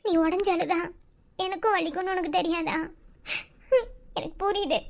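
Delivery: normal talking voice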